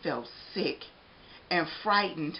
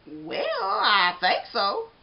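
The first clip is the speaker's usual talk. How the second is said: jolly baker voice; 7 semitones higher, 5 dB louder, much slower than usual. Acted.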